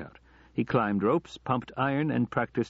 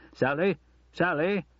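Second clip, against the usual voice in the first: higher-pitched call, rising at the end; mild calling out; 9 semitones higher than usual. A narrator's reading. Father calling for his daughter through the house, mildly shouted. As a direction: call out for someone, understated shout. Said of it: "at the top of his voice".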